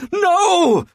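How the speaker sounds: high anguished demon cry; demon's cry. Acted. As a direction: cry out as the hammer falls. Sword smashed, brother cries out.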